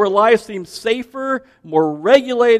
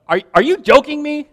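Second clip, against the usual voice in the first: higher, incredulous squeak on you; mimicking a kid's disbelief, pitched up; [name]'s version; 8 semitones higher than usual. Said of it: "[name]'s got that husky".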